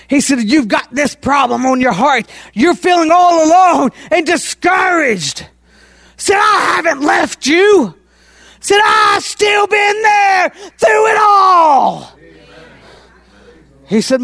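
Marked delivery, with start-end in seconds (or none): 0.4-12.1 rising preacher's shout, slowing down; soaring, stretched pulpit delivery; preaching God's words. Acted. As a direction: call out God's comfort to the congregation. Preacher voicing God's comfort.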